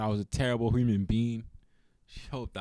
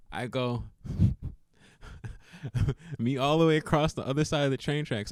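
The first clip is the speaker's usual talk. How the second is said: normal talking voice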